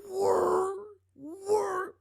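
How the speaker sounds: very high, loud imitated engine whine; cartoonish mouth-made swerve sound; screeching swerve noise. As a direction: wail like tires screeching. Truck swerving, retold.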